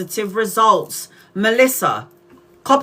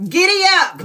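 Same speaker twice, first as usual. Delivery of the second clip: high, louder giddy up; mock giddy up; 9 semitones higher, 4 dB louder than usual. Jokey Texan act urging her horse on, high and bright. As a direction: spur the horse on playfully. Pretend cowgirl riding.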